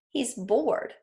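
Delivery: normal talking voice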